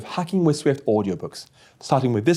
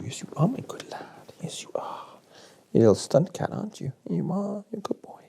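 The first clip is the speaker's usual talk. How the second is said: soft whispered cooing to a pet; pet talk; 5 semitones higher, 7 dB quieter than usual. Real, not acted. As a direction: murmur sweet nothings to the cat, much softer. Cooing to his pet cat.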